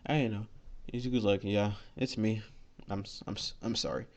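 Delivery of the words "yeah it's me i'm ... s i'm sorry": quiet, halting stutter on the apology. Acted. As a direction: stumble softly over the first sound of sorry. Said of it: "he's stuttering, which is weird".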